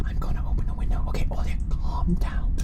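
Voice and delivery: hushed whisper. Real, not acted. Player whispering the choice to herself, whisper. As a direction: breathe it out quietly.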